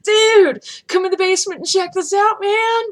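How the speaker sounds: high, loud eager welcome; excited invite. Retold or imitated. Excited friend pulled into the basement, high and loud. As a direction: call him down to the basement, all hyped. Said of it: "I'm like".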